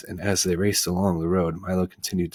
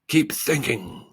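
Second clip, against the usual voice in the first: scolding; 8 semitones higher, slower than usual. Acted. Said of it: "scolded the watchdog".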